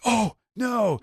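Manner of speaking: mock dismay. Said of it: "they're like".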